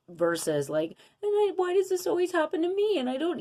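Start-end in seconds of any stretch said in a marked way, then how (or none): 1.2-3.4 high, louder whining complaint; whiny victim tone. Shown as a demonstration. Showing viewers the victim way to speak, exaggerated whine. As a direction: whine like life is unfair to you. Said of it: "listen to the tone of my voice".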